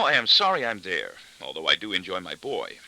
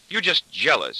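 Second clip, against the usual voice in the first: higher, louder defiant jab; defiant accusation; 6 semitones higher, 5 dB louder than usual. A narrator's reading. Wife to husband who led her away, defiant accusation. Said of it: "she will accuse defiantly".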